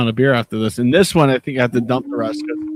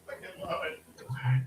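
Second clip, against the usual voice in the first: soft slow whisper; 15 dB quieter, slower than usual. Real, not acted. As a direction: breathe it out in a whisper. Whispered delight.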